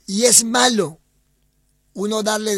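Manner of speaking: forceful, loud admonishment; emphatic warning. Real, not acted. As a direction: come down hard and high on the judgement. Warns against letting people move in.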